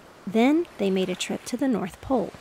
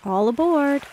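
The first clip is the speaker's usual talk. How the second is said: high, loud toot and call; a boy hooting like a steamship; playful captain calling passengers aboard; toy ship whistle call; 6 semitones higher, 6 dB louder than usual. A narrator's reading.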